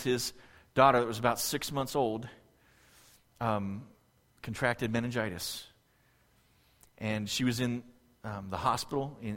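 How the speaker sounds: soft, low storytelling voice; solemn personal testimony. Real, not acted. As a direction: recall a painful memory quietly and gently. Tells of friend's sick baby.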